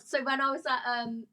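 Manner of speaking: ordinary talk, nothing marked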